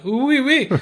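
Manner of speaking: high-pitched mock French accent. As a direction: squeal it like a cartoon French speaker. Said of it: "I can go French on".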